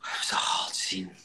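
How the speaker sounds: breathy, hushed, almost voiceless; whispered. Real, not acted. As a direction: say it under your breath, half to yourself. Whispered then repeated aloud.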